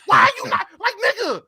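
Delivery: real gamer rage, pitch climbing; raging. Real, not acted. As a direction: vent at the griefer, exasperated. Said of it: "I can see you raging".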